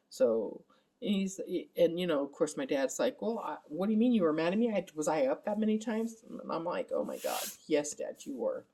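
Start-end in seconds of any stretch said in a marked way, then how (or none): 3.2-6.2 higher, quick, puzzled dad voice; lightly voiced bewildered father; imitating dad. Retelling dad's confused reply, a higher, hurried puzzled voice. 6.9-8.6 low, weary exasperation; exasperated. Retold or imitated. Answering dad at night, a low, exasperated groan of a reply.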